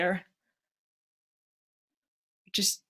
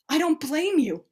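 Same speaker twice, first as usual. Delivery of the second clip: usual speaking voice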